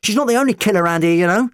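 fast, high, loud accusation; angry shout. A narrator's reading. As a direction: hurl it back at him quickly. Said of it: "Rose shouted at McCavity".

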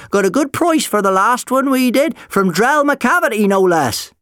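high, loud, indignant boast; old-timer crowing at full volume; boastful old man. Acted. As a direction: brag it across the room. Old man loudly defends his wares.

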